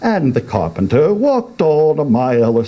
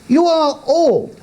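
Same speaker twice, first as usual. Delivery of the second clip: put-on young man voice. Youth voice.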